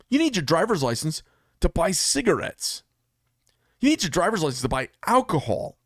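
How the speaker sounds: building indignant list, rising to "vote"; rhetorical build. Real, not acted. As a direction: hammer the repeated "need" like a list of proofs.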